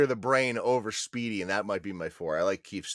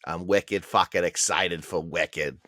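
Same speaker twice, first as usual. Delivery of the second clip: put-on Boston accent; 6 semitones lower, slower than usual. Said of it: "just do shitty Boston accents".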